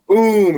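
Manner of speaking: high, loud vocal boom; big drawn-out rifle boom; imitated gunshot. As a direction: mimic the rifle blast. Imitating the second shot.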